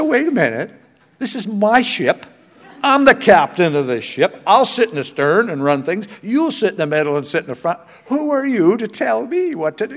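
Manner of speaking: laughing, mock-offended grandpa claiming his ship; mock indignant captain. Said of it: "I said".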